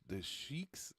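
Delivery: "sheiks" with a SH start and long EE; "sheiks" said like SHEEKS; mispronounced Sikhs. Real, not acted. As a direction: say "sheiks", opening on SH. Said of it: "my bad, not the sheiks".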